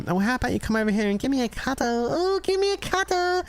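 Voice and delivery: very high, wheedling put-on voice; creepy cuddle voice. Streamer clowning for viewers with a voice changer, high and wheedling. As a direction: coax it like a creepy charmer.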